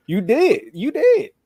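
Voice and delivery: high laughing "you did"; laughing tease. Real, not acted. Teasing his co-host for falling for the hype, laughing. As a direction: laugh and rub it in.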